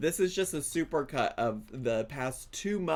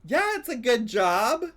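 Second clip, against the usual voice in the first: mock bartender; 8 semitones higher, 6 dB louder than usual. Mocking repeat of bartender.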